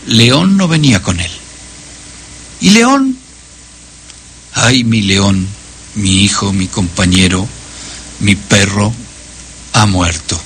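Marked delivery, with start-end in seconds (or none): 2.6-3.2 high, startled little cry of a question; surprised child's question. A narrator's reading. Children ask the blind man about his dog, high and startled. 4.5-10.4 lower, hushed mourning for a lost dog; grieving lament. A narrator's reading. Blind old man mourns his dead dog to the children, hushed and grieving. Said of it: "Y el ciego lloraba a lágrima viva".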